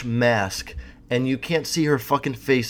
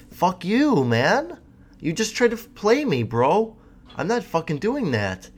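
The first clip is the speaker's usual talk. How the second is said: higher, a mock-tough brush-off; mock indignant retort; 6 semitones higher than usual. Telling off a street hustler, mock indignant. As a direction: snap back like he's offended.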